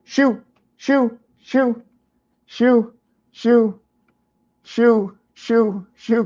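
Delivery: high sing-song shoo to a pet; shooing an animal. Real, not acted. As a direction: coax the dog along in a high voice. Shooing the dog away.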